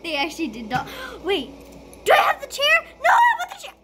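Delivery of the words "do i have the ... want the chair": high, loud yelling for the chair; shouting demand. Real, not acted. Student yells at the others to get the chair, high shouting.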